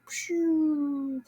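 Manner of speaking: long high falling whoosh; whooshing pshoo. Retold or imitated. Vlogger mimicking a car dropping, drawn-out whoosh. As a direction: make the car's pshoo sound. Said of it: "so it's like".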